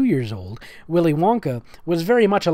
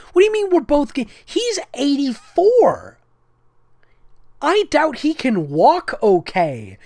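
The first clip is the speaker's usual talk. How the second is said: incredulous outburst; 10 semitones higher, 4 dB louder than usual. Real, not acted. Outraged at the story logic.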